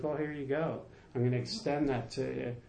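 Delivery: lightly voiced dad, talking low and easy; dad's easygoing advice.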